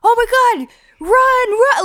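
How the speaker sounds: mock frantic alarm. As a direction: yell at her to run, all fake. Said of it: "we would just be like".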